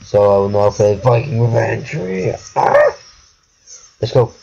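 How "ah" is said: sudden very high shriek; high-pitched scream. Pretend. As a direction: let out a short, piercing high yelp. Scream after announcing Viking Revenge.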